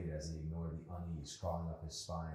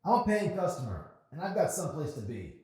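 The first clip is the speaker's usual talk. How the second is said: angry customer; 8 semitones higher, 8 dB louder than usual. Acted. Rude customer complaining.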